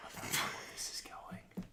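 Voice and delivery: very soft, slow whisper; whispered aside. Real, not acted. Listener doubting where the story goes, whispered.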